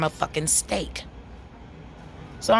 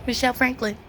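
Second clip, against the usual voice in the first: higher, puzzled employer voice calling the name; mock employer; 7 semitones higher, faster than usual. Interviewer calling out her name, puzzled and higher. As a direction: play the confused interviewer. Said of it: "they're looking like".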